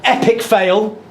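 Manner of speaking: "Epic fail" with a rising, questioning end. Retold or imitated. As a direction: say "Epic fail" as a puzzled query. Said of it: "Epic fail, dot, dot, dot, question mark".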